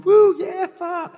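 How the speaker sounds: high-pitched mock whoop, falling away in volume; imitated American cheer. Comic imitating people cheering America, high mock whoop. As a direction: whoop like an over-keen audience member. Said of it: "I heard people going".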